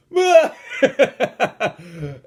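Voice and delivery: loud, high cackle at own punchline; burst of laughter. Real, not acted. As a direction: crack up loudly.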